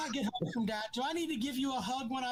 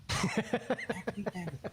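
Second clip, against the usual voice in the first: slower, in a put-on mocking voice; slow teasing repeat to rub it in; mocking mantra; 4 semitones lower than usual. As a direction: drag it out like a taunting chant. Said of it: "go to bed tonight and think".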